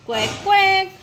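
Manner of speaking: playful imitated quack; mock duck quack. Shown as a demonstration. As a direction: quack like a cartoon duck for baby.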